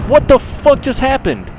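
loud, high disbelief; shocked outburst. Real, not acted. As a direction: yell it in total shock. Sudden shock in firefight.

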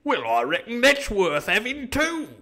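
slow, high, swooping pirate delight; joyful cry. A narrator's reading. Dick is relieved about his Bible, joyful. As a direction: crow it with gormless relief. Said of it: "cried Dick with a sort of joy".

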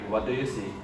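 ordinary talk, nothing marked

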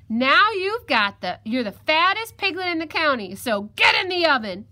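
loud, high fox giving orders; bossy fox. Acted. Fox orders the washed piglet to stay, bossy. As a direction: declare it loudly, then order firmly.

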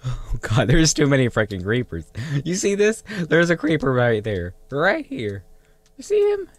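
loud, high urgent pointing; excited alarm. Real, not acted. Gamer alarmed at many creepers. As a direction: call the viewers' eyes to danger.

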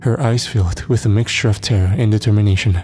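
ordinary delivery, nothing marked

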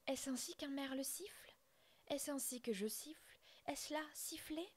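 questions lancées plus aiguës, scandalisées; le père outré s'étrangle d'indignation; indignation aiguë. Acted. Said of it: "s'écria-t-il". (père merle indigné, voix plus aiguë)

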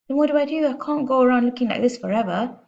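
high, loud, fretful protest; panicked. A narrator's reading. Father desperate for a remedy, panicked.

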